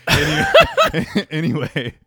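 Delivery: breaking into a high laugh on anyway. Real, not acted. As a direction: giggle through the word. Laughs mid-word.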